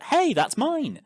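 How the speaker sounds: high, loud startled objection; indignant protest. Acted. Shepherd has his crook yanked away, indignant cry. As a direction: cry out as if just robbed.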